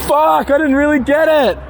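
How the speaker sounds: anguished wailing. Real, not acted. Photographer sees shot is out of focus, anguished wail. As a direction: wail in dismay, half laughing.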